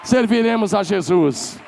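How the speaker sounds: high, loud proclamation; loud declaration. Shown as a demonstration. Pastor leading the congregation's vow, loud and high. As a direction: proclaim it, lift the voice.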